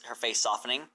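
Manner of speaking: ordinary delivery, nothing marked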